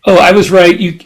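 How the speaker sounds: ordinary talk, nothing marked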